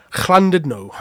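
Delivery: Llandudno said with a hard c-like start; Welsh place name. Shown as a demonstration. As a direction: Llandudno as klan-did-no. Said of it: "this is how you pronounce it".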